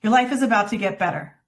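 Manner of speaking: fast, flat, rushed through; quick and flat. Shown as a demonstration. Coach demonstrating the forgettable version, fast and flat. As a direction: rattle it off with no tone. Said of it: "Say it quickly and flat".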